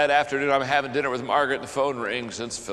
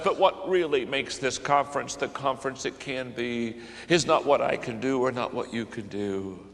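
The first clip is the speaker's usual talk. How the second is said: ordinary talk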